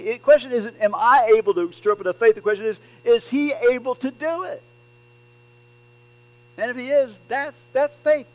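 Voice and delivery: preaching at full force. Acted. Preacher urging the congregation to trust God, loud and driving. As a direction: press the point home to the congregation.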